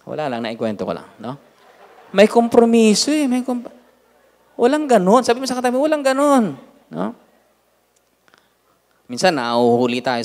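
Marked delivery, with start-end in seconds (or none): 2.1-7.2 high, loud fervent preaching. Acted. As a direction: drive the point home to the church. Pastor preaching to congregation. (masigasig na pangangaral nang malakas)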